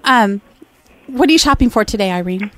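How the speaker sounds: ordinary talk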